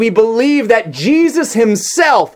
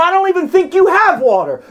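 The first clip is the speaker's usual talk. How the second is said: scoffing woman; 11 semitones higher than usual. Retold or imitated. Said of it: "She goes from".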